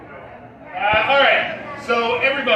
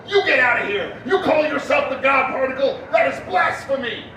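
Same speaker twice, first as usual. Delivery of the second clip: outraged priest, pitched up; indignant priest; 6 semitones higher than usual. Acted. Priest ordering the particle out of church, indignant raised voice. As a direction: denounce it like a scandalized priest. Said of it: "the priest comes out and goes".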